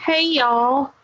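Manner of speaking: high, cartoonish Southern twang; hammed-up Southern greeting; bad Southern accent. Shown as a demonstration. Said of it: "I can do a really bad Southern accent".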